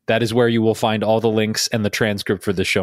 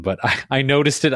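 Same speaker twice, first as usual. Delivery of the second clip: ordinary talk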